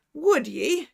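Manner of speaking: Irish doctor catching him out; Irish cry. Acted. Irish doctor crying out while gripping the fleeing man. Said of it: "he cried".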